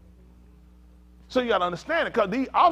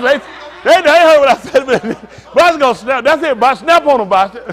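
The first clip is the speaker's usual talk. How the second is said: loud, raised-pitch excitement; excited egging on; 8 semitones higher, 14 dB louder than usual. Real, not acted. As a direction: cheer them on at full volume. Egging on a participant, laughing.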